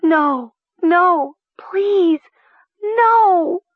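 high, loud, pleading wail; anguished high-pitched begging. A narrator's reading. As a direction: wail in horror, each word very high. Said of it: "she began to wail".

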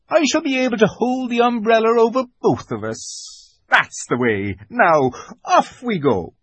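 high creature voice, politely bustling; Tumnus, gallant. A narrator's reading. Fawn offers Lucy his arm, high gallant voice. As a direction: usher her along as a courteous fawn.

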